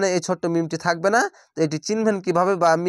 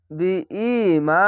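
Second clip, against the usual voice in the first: ordinary talk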